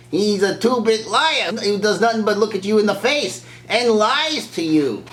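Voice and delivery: high, emphatic accusing rant; hammed-up outraged accusation; mock denunciation. Obi-Wan voiced denouncing the uncle.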